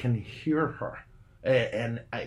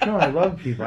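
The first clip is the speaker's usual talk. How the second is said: louder laughing protest; 6 semitones higher, 9 dB louder than usual. Real, not acted. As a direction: laugh while taking the joke back. Laughing correction of own joke.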